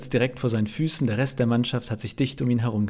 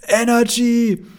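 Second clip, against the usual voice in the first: very high, loud drawn-out yell; full-volume roar; 12 semitones higher, 8 dB louder, much slower than usual. A narrator's reading. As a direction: bellow it at the top of your lungs. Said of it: "brüllt in voller Lautstärke". (aus voller Kehle gebrüllt)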